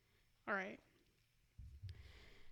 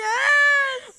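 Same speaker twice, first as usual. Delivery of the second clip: very high, loud shout of yes; cheering the story's cute ending; joyful shout; 13 semitones higher, 13 dB louder than usual. Real, not acted. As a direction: yell it out in glee. Shrieking delight after story ends.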